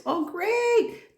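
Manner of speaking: a very high delighted "Oh, great". Retold or imitated. Cheering the girl about her loving parents, very high and delighted. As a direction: jump way up in pitch with joy.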